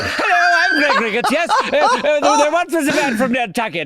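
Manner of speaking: high, loud, laughing mock British; imitating a posh Englishman, hammed up; vaguely British. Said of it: "a vaguely British version of".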